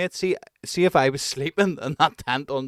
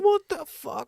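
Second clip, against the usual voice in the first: mock startled; slower than usual. Retold waking beside kicking legs.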